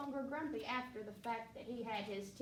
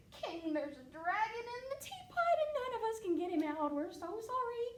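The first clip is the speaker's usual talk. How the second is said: high apologetic cook; 10 semitones higher than usual. Acted.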